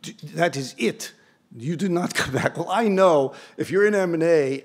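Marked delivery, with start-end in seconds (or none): 0.0-2.6 imitated stern Swiss accent; final word in a put-on Swiss accent; mock Swiss banker, each word separate. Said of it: "if that's a good Swiss accent".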